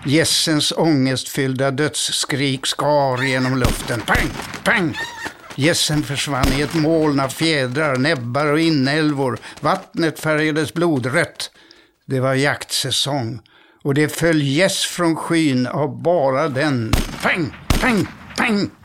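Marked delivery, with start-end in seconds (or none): 4.0-5.0 mock gunshots. A narrator's reading. Gunshots in the story. 17.2-18.7 mock gunshots. A narrator's reading. Gunshots in the story.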